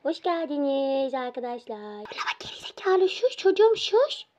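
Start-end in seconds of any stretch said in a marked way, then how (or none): 1.8-3.1 harsh little whisper. Pretend. Sneaking past sleeping parents. 3.1-4.3 high, loud hushing at the dog; shushing. Pretend.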